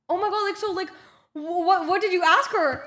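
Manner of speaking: high, loud imitation of eager questioning; excited friends. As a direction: mimic friends bursting with curiosity. Voices friends' excited reaction.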